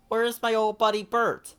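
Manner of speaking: high, bright puppet-like Ernie; eager Ernie calling his friend. Acted. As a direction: lift into a cheerful high Ernie. Ernie's voice in story.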